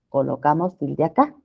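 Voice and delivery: ordinary talk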